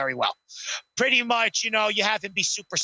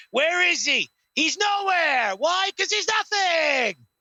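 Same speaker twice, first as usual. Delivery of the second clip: hammed-up wrestling promo; Rock impression; 6 semitones higher, slower than usual. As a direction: gloat to the crowd like The Rock. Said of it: "The Rock just goes".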